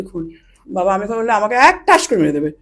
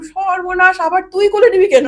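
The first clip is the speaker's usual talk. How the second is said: 1 alarmed exclamation; 6 semitones higher than usual. Retold or imitated. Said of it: "আমি".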